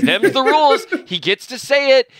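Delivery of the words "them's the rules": high, loud, laughing them's the rules; sarcastic laughing refrain. Real, not acted. Host mocking the rules to cohost with a laugh. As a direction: toss it off with a laughing shrug.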